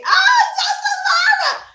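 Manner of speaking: real delighted high shout with a laugh; excited squeal. Real, not acted. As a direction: burst out squealing with joy at the compliment. Viewer praise, excited squeal.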